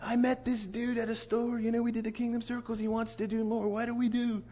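high, soft dude on a monotone; [name]'s dude voice. Retold or imitated. [name] phoning his team leader for help, high dude voice. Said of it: "What's up, [name]?".

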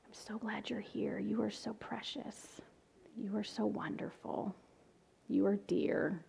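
soft, slow whispered comfort; whispering comfort to a boy on her lap. Retold or imitated. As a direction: breathe it into a child's ear. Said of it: "I just whisper in his ear".

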